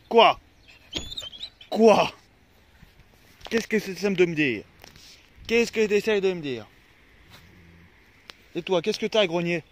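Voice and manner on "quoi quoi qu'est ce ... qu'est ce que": calling to dog. Real, not acted. Answering barking dog loudly.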